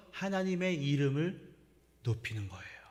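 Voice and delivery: ordinary delivery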